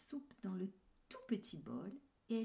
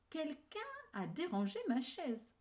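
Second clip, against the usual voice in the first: a lightly raised, medium bear voice; just a bit higher, the middle bear; 7 semitones higher than usual. A narrator's reading. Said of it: "d'une voix moyenne". (voix moyenne de l'ours)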